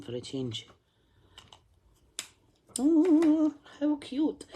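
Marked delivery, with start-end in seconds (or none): 2.8-4.4 much higher, louder cooing oh; delighted squeal. Real, not acted. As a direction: gush over something adorable. Delighted at the drawing.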